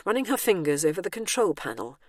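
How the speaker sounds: ordinary delivery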